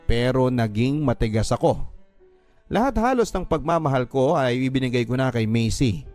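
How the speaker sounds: ordinary delivery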